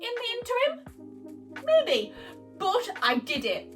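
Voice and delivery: ordinary talk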